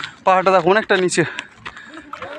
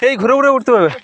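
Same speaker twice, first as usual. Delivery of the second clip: out of breath; 4 semitones higher than usual. Real, not acted. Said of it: "দম লেগে গেল".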